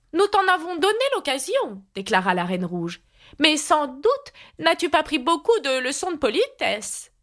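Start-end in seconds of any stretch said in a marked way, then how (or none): 0.1-1.8 high, reproving queen voice; haughty Red Queen. A narrator's reading. Red Queen reproving Alice, high queenly voice. 3.4-7.1 high, reproving queen voice; haughty Red Queen. A narrator's reading. Red Queen lecturing Alice on manners, high queenly voice.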